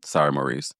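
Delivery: ordinary talk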